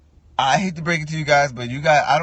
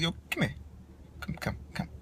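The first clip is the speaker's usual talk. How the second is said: hushed call over; 14 dB quieter, faster than usual. Retold or imitated. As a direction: pull the worker aside under your breath. Quietly calling a rude worker over.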